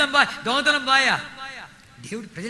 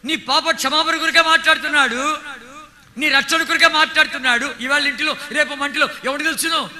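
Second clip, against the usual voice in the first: fiery preaching; 4 semitones higher, 5 dB louder than usual. Acted. Preacher urging the congregation to repent, fiery preaching. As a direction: preach it out to the crowd, full force.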